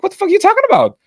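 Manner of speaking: high-pitched retold outrage; reenacted incredulous protest; indignant disbelief. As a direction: act out exasperation with the voice way up. Retold quarrel with rude customer.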